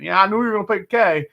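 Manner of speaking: playful Kentucky accent imitation. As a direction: toss it off in a light Kentucky accent. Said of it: "that Kentucky, like, accent".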